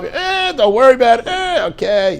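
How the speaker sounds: very high, put-on dismissive reassurance; helpline schmoozer. Helpline man answering someone who called up, comic reassurance. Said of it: "call up".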